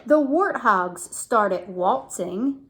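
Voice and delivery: lively storybook read; reading to children. Acted. Mother reading to her son, lively storybook voice. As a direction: read it out to a small child with bounce. Reads picture book to child.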